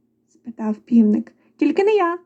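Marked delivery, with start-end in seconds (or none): 1.6-2.2 high-pitched little mouse; mouse crying out. A narrator's reading. First mouse refusing to carry grain, high cry. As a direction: squeal a quick refusal. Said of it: "скричав круть".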